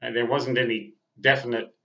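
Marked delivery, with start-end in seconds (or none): none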